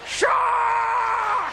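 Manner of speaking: high, drawn-out alarm shout; big loud shout. Acted. First Aussie sighting a shark, big loud shout. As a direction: yell it long across the water. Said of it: "It's a big, loud word".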